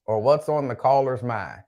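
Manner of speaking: ordinary talk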